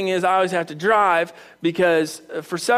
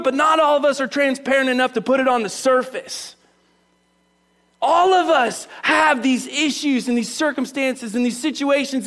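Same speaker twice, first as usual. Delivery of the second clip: raised, emphatic sermon delivery; impassioned preaching; 6 semitones higher, 3 dB louder than usual. Real, not acted. Preacher urging church they're not alone, impassioned. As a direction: drive each phrase home, pitched high.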